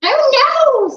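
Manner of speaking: high loud witch screech. Acted. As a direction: shriek it in horror. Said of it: "screeched the witch".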